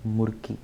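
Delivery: short oo in "मुर्की", echoing मुर्गी; "मुर्की" sounding like मुर्गी. Shown as a demonstration. Teacher links the word to hen for memory, "मुर्की" sounding like मुर्गी. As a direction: say "मुर्की" close to मुर्गी, with oo first. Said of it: "मुर्गी की sound लाओ".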